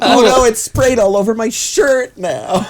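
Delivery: high, slow hammed-up dismay; mock dismay. Caught by stepbrother at dishwasher, mock coy dismay. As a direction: draw out the coy distress, half laughing.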